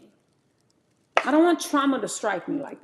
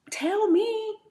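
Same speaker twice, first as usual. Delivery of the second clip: mock pleading; 10 semitones higher than usual. Pleading with friends, high pitch.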